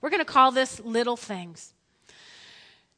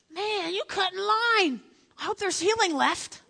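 higher-pitched aggrieved complaint; mock indignation; 8 semitones higher than usual. Jairus griping at the woman who cut in, indignant. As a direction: protest like someone who got cut in front of. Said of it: "Jairus is probably thinking".

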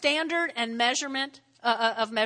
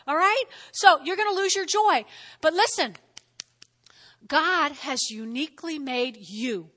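ordinary talk

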